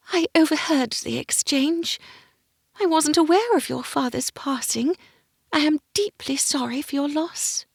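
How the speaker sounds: gentle lady voice. Acted. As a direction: speak like a kind court lady. Miranda speaking, lady voice.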